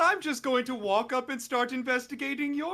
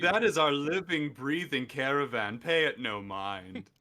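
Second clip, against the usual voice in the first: dismissive deadpan; 8 semitones lower than usual. Acted. Player covering for the caravan, low deadpan. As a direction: wave it away, dry.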